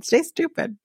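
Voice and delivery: lifted high, quick and mocking. Shown as a demonstration. Vlogger mocking men, pitched high.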